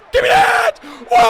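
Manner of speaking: high, loud yell for the ball; real shout. Real, not acted. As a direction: scream it, demanding the pick. Yelling for an interception.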